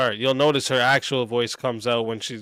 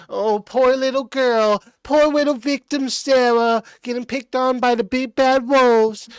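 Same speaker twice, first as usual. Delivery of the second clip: mocking victim voice; 10 semitones higher than usual. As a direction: mimic a helpless victim with mocking pity. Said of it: "you're fucking".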